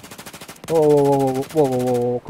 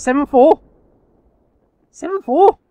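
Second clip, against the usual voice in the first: disbelief; 12 semitones higher, 7 dB louder, slower than usual. Real, not acted. Disbelief at enemy's score.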